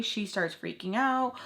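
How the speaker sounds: ordinary talk, nothing marked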